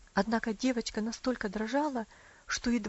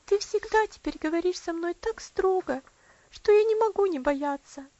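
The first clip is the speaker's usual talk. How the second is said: put-on frightened little girl; 8 semitones higher, 6 dB louder than usual. A narrator's reading. Girl voiced in story.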